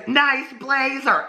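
put-on high, slow mean-girl sneer; drawn-out sarcastic compliment; mean sarcasm. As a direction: draw it out high, dripping with fake praise. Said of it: "She's real mean".

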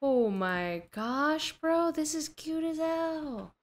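high, drawn-out gush; delighted gushing. Real, not acted. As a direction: let it climb into a long coo. Gushing over a cute item.

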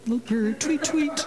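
high tweeting bird chirps; playful high tweet tweet; little bird. Pretend. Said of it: "I feel like a little bird".

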